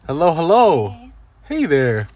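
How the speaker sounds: high sing-song welcome. Real, not acted. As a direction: coo a welcome like to a little one. Greeting wife coming home, sing-song. (صوت حنون كأنه يكلم طفلًا)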